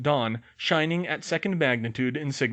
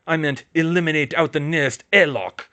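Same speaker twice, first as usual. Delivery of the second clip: jokey put-on Russian accent; hammed-up Russian accent, louder; fake Russian accent; 2 semitones higher, 6 dB louder than usual. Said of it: "he said with a fake Russian accent".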